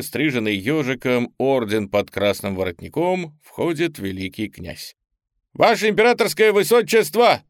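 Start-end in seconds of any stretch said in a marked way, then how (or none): 5.5-7.4 loud, high clipped military report; crisp report. Acted. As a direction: rap it out like a cadet. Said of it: "чеканить рапорт".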